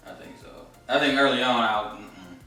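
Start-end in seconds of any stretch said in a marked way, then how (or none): none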